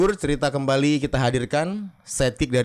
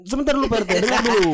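put-on pleading kid; 7 semitones higher, 3 dB louder, much faster than usual. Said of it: "bilang Pak R T".

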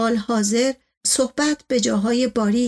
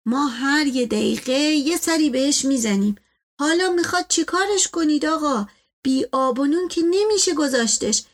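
higher-pitched deferential servant voice; persuasive servant; 4 semitones higher than usual. Acted. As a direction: coax the master in a raised, lilting voice. Servant figure persuades his master.

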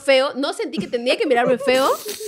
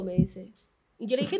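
ordinary talk

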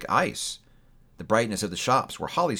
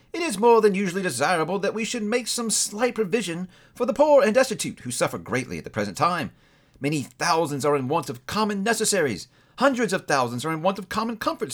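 lightly voiced courteous gentleman, somewhat higher; polite charity gentleman; 7 semitones higher than usual. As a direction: ask for the poor with gentle earnestness.

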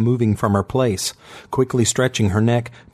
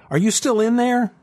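sharp, higher, annoyed call; angry prodding; 13 semitones higher than usual. A narrator's reading. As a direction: prod him angrily. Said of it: "Megan prodded angrily".

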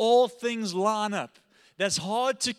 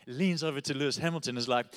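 ordinary talk

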